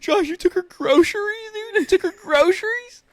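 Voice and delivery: hammed-up high kid voice, near crying; squeaky twelve-year-old voice. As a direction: squeak it out like a whiny preteen boy. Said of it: "how our voices sound".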